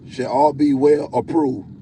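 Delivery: ordinary talk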